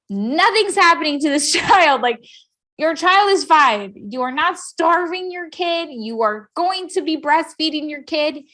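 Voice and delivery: high, emphatic mock indignation; mad doctor. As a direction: insist word by word, imitating an angry doctor. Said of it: "so mad".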